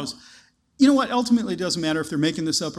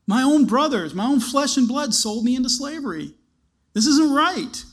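indignant protest; 6 semitones higher, 3 dB louder than usual. Acted. Joseph sold into slavery.

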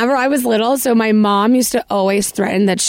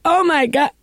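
high gasped oh my God; mock shock; 7 semitones higher than usual. Teen hearing dad's guilt trip, high mock shock. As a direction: react like the words hit hard. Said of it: "And I was just like".

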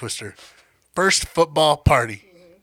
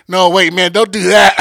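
stoner voice; 2 semitones higher, 8 dB louder than usual. Retold or imitated. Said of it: "[name] and [name] fucking".

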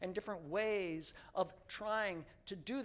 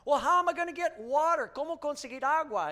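exasperated Henry; 8 semitones higher, 8 dB louder than usual. Retold or imitated. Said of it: "Henry complains in song".

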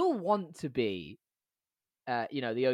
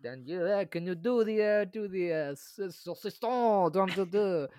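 light mock French accent, a bit higher; 3 semitones higher than usual. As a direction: play the French-ish acrobat thief. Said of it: "why we're doing it in French".

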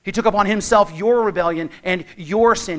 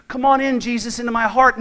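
mocking casual invitation; 5 semitones higher, 5 dB louder than usual. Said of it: "your response isn't to".

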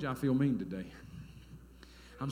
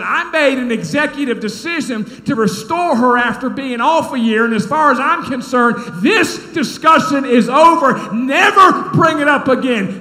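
loud, high, forceful dressing-down; aggravated; 9 semitones higher, 16 dB louder than usual. Retold or imitated. Pastor shutting down the council member's complaint, aggravated. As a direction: lay down the law at full volume. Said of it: "'cause I was aggravated".

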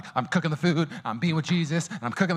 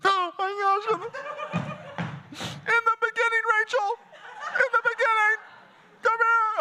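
hammed-up awe; slower than usual. Overcome while reading Genesis.

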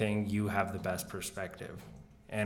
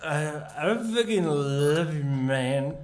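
put-on slurring happy drunk; 5 semitones higher, 5 dB louder, slower than usual. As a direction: gush like a sloppy drunk. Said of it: "You make the best drunk voice".

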